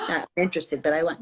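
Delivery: ordinary talk, nothing marked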